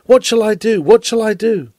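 high, loud, desperate repeated plea; anguished cry. Retold or imitated. Preacher voices the sinner's despair, anguished and loud. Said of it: "with what anguish we chattered to ourselves".

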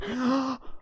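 startled sharp intake of breath; sharp gasp. Real, not acted. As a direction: gasp as if something lunged. Jump scare hits.

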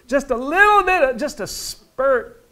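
high, loud mock-innocent plea; mock protest. Preacher excusing the spray in the drink, high mock protest. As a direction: squeal it out loud, minimizing playfully. Protesting his spit was tiny.

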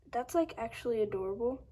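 normal talking voice

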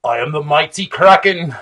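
loud, hammed-up Kraken proclamation; mock-mighty monster. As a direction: proclaim it loudly like a sea monster warming up. Grandiose line to finish voice warm-up.

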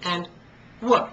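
normal talking voice

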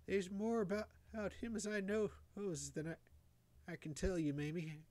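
high-pitched, afraid country woman; anxious high voice, stumbling over the words; fearful complaining mother. A narrator's reading. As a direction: confide fearfully in high rustic dialect. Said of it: "poor creature complained to Mamie Bishop of being afraid".

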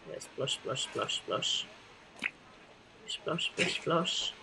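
low whispered splashing sound; whispered splosh. Pretend. Playing with the tap, whispered splash sounds. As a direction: breathe the splosh as water noises.

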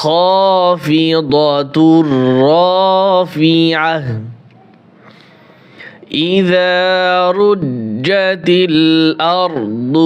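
slow, drawn-out tajweed chant; Quran recitation. Shown as a demonstration. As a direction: recite each word with long held vowels. Recites the verses. (ধীরে টেনে টেনে কোরআন তিলাওয়াত)

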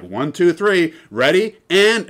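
building countdown. Retold or imitated.

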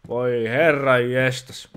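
ordinary talk

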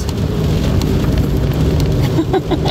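helpless high wheezing laugh; high breathless laughter. Real, not acted. Streamer losing it over the clip, wheezing laugh. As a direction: laugh till the air runs out.

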